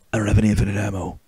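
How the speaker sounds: low, quick game-hero voice; low, softened tough-guy reply; Snake. Acted. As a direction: mutter it deep and fast like a soldier. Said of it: "my Snake voice is not as good".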